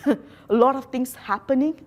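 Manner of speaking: ordinary talk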